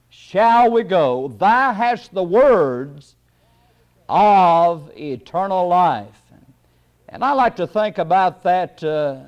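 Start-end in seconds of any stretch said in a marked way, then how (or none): 0.1-6.2 slow, weighty stress on each of "words of eternal life"; measured, deliberate Bible quotation; emphatic scripture quote. Real, not acted. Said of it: "putting too much emphasis upon the Bible".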